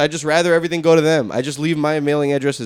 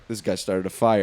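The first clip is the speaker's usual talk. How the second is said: low, soft deadpan cop voice; mock cop conclusion; 10 semitones lower, 6 dB quieter than usual. Mimicking the officer who blamed him, low and soft. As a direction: say it low like a smug cop closing the case. Cop's assumption voiced in story.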